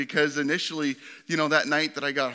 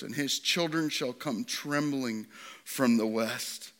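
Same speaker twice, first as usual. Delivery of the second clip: tender scripture reading; 5 semitones lower, 6 dB quieter than usual. Acted. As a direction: read it gently, like God speaking tenderly. Reads Hosea scripture tenderly.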